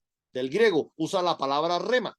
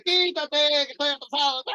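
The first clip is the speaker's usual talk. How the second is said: high, raised, impatient call at the car ahead; impatient driver; 7 semitones higher than usual. Pretend. Late driver yelling at the car ahead, impatient high shout.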